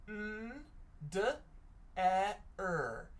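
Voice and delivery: A, N, D, E, R as separate sounds "uh, nn, duh, eh, rr"; sounding out. Shown as a demonstration. Teacher sounds out under together with children, sound by sound. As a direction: say each letter's sound alone, with gaps. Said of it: "Let's sound this out together".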